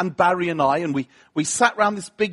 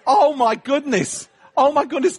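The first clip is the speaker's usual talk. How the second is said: overwhelmed with wonder, laughing through it; excited awe; 5 semitones higher than usual. Retold or imitated. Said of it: "I was like".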